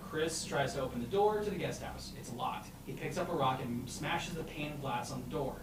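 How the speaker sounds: ordinary talk, nothing marked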